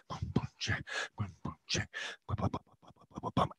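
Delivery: soft unvoiced beatbox dying away; whispered beatbox fading out. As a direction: let the beat fade to a breath.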